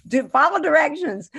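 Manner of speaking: mock impatient rant, rising loud; exasperated thought. Actress scolding her own character, mock exasperated. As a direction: scold the whiner in your head. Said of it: "and I thought".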